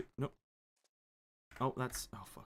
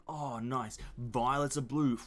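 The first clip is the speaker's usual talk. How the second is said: ordinary talk, nothing marked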